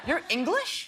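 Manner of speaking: high, incredulous question; surprised fan. Retold or imitated. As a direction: imitate a fan taken aback. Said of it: "And they go".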